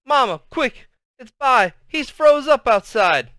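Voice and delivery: high, loud call for help; excited kid shouting for Mama; urgent alarm. A narrator's reading. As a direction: burst in and raise the alarm. Boy runs in raising the alarm.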